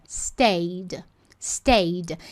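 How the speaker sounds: "Stayed" as one syllable, no e sounded; correct pronunciation. Shown as a demonstration. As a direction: say "Stayed" like "steid". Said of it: "A gente não pronuncia esse E". ("Stayed" sem pronunciar o E)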